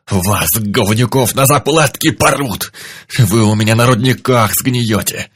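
loud outraged threats, slowed; furious shouting. Acted. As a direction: bellow threats at the captors. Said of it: "возмущённо замычал".

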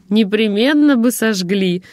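plain reading voice

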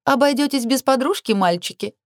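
high sing-song teasing question; playful high-pitched needling. A narrator's reading. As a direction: taunt the boys in a high lilt. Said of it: "пропела Наама".

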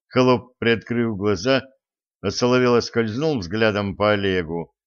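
plain reading voice

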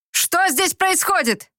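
high, slow call; shouted question. Acted. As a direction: call out across the yard. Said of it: "крикнул Ингвар".

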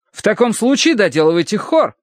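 high-pitched fussy reprimand; scolding clergyman. A narrator's reading. Pale clergyman rebukes a workman, scolding. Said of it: "выговаривал бледный священник".

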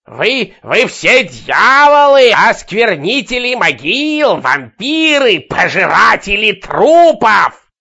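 high, slow frenzied shrieking; shrill scream. Acted. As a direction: hurl accusations like a madwoman. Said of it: "пронзительно кричала она".

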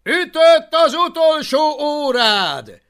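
slow, loud, high commanding bellow; menacing roar. A narrator's reading. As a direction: order the gates open. Alvin threatening, commanding soldiers.

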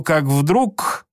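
usual speaking voice

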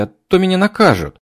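put-on high whiny girl's pleading; whining girl. A narrator's reading. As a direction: whine like a scolded young maid. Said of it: "заныла горничная".

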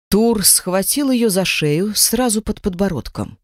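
usual speaking voice

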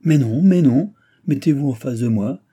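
loud, high interjection easing into an instruction; urgent protest. A narrator's reading. Sister stopping the youth from climbing down, urgent protest.

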